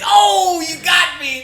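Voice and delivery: high, loud mock outcry; mock concession. Caller pretending the postal worker's line floored him, mock shout. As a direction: cry out as if floored by the zinger. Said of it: "expected me to just be like".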